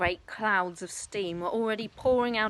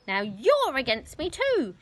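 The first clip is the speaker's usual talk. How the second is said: loud, higher, slow wounded shout; aggrieved shout; 6 semitones higher, 6 dB louder than usual. Acted. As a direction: hurl it as a complaint. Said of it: "shouted Grandma".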